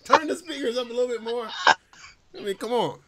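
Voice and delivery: ordinary talk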